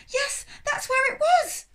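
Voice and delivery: high, slow eager voice; eager excitement. A narrator's reading. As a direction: gush with relief. Sophie excited.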